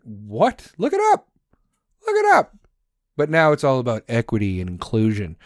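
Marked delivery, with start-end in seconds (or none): 0.8-1.3 very high, squeaky mock insistence; a mock squeal of insistence; squeaky look it up. 2.1-2.5 very high, squeaky mock insistence; a mock squeal of insistence; squeaky look it up. Repeated squeak.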